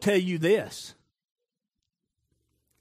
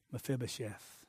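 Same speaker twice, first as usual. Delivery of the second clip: soft call; 6 semitones lower, 14 dB quieter than usual. Acted. Tender calling by name again.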